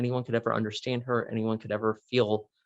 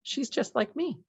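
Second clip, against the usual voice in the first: put-on young girl voice; 12 semitones higher than usual. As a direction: voice the ten-year-old, much higher. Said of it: "she goes".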